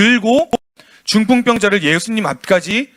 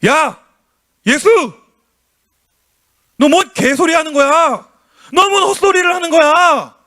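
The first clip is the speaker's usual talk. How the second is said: angry scoffers; 6 semitones higher than usual. Acted. Scribes jeering at Jesus, a hammed-up angry shout. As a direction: shout them down like furious critics. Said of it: "지금 이렇게 이야기를 하는 거예요".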